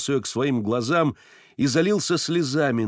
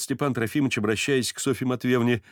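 plain reading voice

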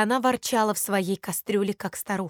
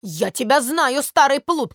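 louder, higher scolding bird; starling shouting; 4 semitones higher, 6 dB louder than usual. A narrator's reading. Starling shouting at the cat from its cage. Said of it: "кричит скворец сверху".

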